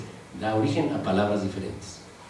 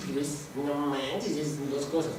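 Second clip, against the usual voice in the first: mock linguist; 8 dB quieter than usual. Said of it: "un lingüista dice".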